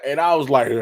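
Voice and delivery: ordinary talk, nothing marked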